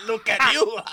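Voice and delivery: put-on loud, high Mexican-accented taunt. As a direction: size him up in a hammy Mexican accent. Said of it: "I can't do the accent".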